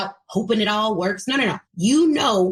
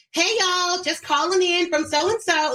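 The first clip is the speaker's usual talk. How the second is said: higher-pitched friendly Georgia phone greeting; Georgia accent; 8 semitones higher than usual. Retold or imitated. Sales caller ringing Georgia prospects, put-on Georgia accent. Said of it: "if I'm calling Georgia".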